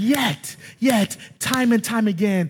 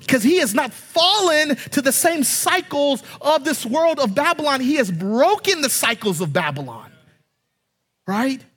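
normal talking voice

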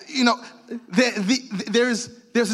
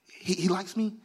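soft, slightly rising, hopeful daisy-petal count; tentative hope; 4 semitones lower, 7 dB quieter than usual. Acted. Plucking petals about God's favour.

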